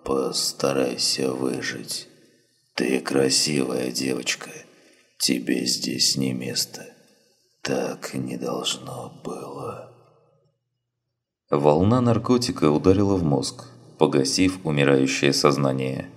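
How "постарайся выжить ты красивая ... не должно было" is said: fading to a whisper, very slow; last words, dying away to nothing; barely audible. A narrator's reading. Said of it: "на пределе слышимости".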